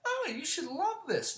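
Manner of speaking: very high mock voice; mocking mimicry. Parroting people who say he should love cold, mocking. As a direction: imitate them in a silly high pitch. Mocks what people tell him.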